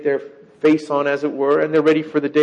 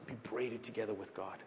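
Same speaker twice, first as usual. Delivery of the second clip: softer, intimate emphasis; softly insisting; 6 semitones lower, 21 dB quieter than usual. Retold or imitated. Preacher softly telling his congregation to be braided with God. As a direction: confide it gently, like a secret. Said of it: "what he's saying is".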